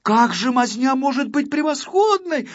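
put-on high, loud girl pupil's amazement; astonished. A narrator's reading. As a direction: question it in astonishment. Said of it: "удивилась ученица Акварелька". (удивлённо, высоким голосом)

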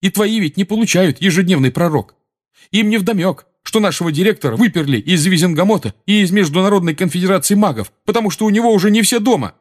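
high-pitched, loud, agitated; indignant tirade, speeding up at the end; heated rant. A narrator's reading. Seamus telling Dean about Dumbledore's dismissal, heated rant.

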